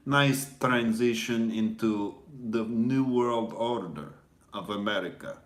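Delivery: put-on Ivan Drago-like Russian; Russian accent. Fake Russian agent telling opponents to submit, Russian accent. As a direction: boast in a mock Russian accent, halting. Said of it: "this is my fake accent".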